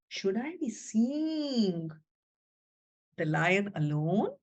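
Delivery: soft, slow doubtful musing; wondering aloud. Acted. Zelda asks herself about meeting the lion, soft and slow. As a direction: ponder it quietly to herself. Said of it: "Zelda was left thinking".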